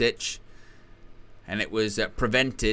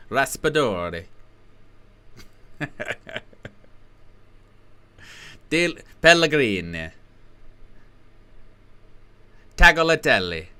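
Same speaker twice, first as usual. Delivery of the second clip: light mock Italian accent on the names; hammed-up Italian accent on player names. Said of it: "Jonathan Pierce is loving the Italian accent".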